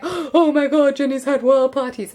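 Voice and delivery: mock scandalised class. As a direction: imitate a whole class gasping at gossip. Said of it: "the entire class was like".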